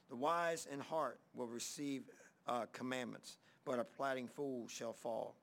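low, measured Bible reading voice; scripture reading. Acted. Reads scripture.

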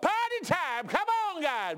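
high-pitched rallying holler; imitating guys yelling for a party; excited yell. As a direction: yell it out to the whole crew. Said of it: "my guys yelled out".

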